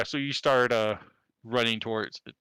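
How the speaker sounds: ordinary talk, nothing marked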